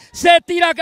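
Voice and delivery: normal talking voice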